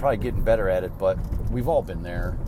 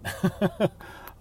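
airy unvoiced chuckle, higher; soft breathy laugh; 9 semitones higher, 3 dB quieter than usual. Real, not acted. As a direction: laugh it off lightly. Laughs at own joke.

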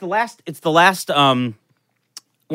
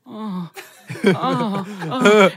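mock moaning; 4 semitones higher, much slower than usual. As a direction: imitate a lover's rising moans. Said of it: "sort of moaning a little bit".

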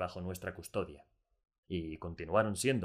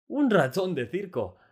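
much higher and louder, slow and delighted; joyful exclamation; 10 semitones higher, 9 dB louder, slower than usual. A narrator's reading. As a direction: burst out with pure joy. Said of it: "exclamó Delacroix, rebosante de alegría".

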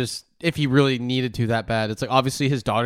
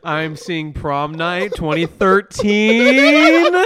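mystic fortune teller; 3 semitones higher, 7 dB louder, much slower than usual. Acted. Mock psychic reading.

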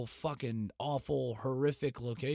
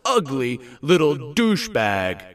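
furious shout; 5 semitones higher, 15 dB louder than usual. Real, not acted. Host screams at dead dictator on podcast, furious shout. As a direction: scream it at him with full rage. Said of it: "I would just like to say".

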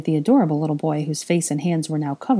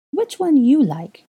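put-on toddler voice; 9 semitones higher, 5 dB louder, slower than usual. A narrator's reading.

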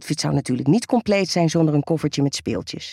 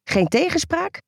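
strict nurse; 9 semitones higher than usual. Acted.

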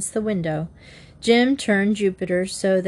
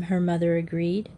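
plain reading voice

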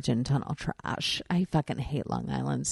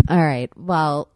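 ordinary talk

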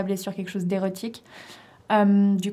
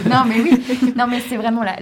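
laughing, higher and louder; 4 semitones higher, 9 dB louder than usual. Real, not acted. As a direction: laugh out the protest. Laughs after teasing remark.

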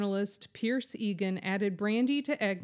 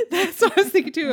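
laughing fast and loud; 8 semitones higher, 10 dB louder, faster than usual. Real, not acted. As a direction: burst out laughing while speaking. Laughing at the shared joke.

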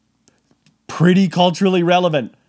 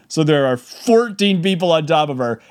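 ordinary delivery

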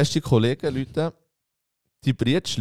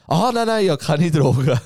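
higher, hastily protesting innocence; panicky Hassan; 5 semitones higher, 5 dB louder than usual. Retold or imitated. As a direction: play the nervous friend caught by police, voice pushed up. Hassan answers the police. (Hassan beteuert panisch und höher seine Unschuld)

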